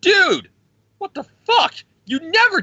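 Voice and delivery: very high, loud cry of disbelief; shocked squeal. Retold or imitated. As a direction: shriek it way up high, stunned. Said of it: "they're all like".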